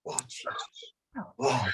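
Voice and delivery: hushed whispered cue. Real, not acted. Teacher whispers to the group mid-exercise. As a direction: whisper so as not to break the calm.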